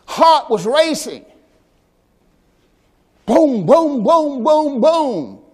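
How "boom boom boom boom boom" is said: heavy pounding booms, each one falling; heartbeat booms. Retold or imitated. Pastor imitates the girl's racing heartbeat as heavy booms. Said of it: "That's how it sounded".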